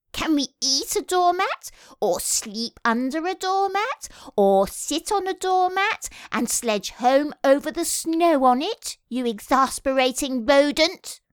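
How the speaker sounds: high-pitched sarcastic rant; exasperated. A narrator's reading. The Rat berates the Mole over a doormat, exasperated. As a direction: pile up incredulous questions, pitched high.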